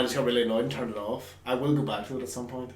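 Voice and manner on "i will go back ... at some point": usual speaking voice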